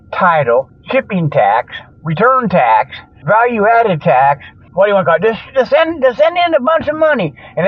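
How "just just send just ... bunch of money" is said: huckster pitch. Pretend. Mock pitch asking for money.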